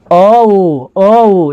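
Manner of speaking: "ओ" stretched into a long, slow glide; slow-motion demo of the vowel "ओ"; slow pronunciation. Shown as a demonstration. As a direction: draw out "ओ" for learners, sliding through the vowels. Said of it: "जरा पढ़िए तो इसका slow उच्चारण".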